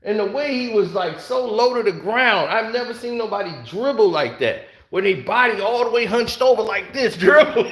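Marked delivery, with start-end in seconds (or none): none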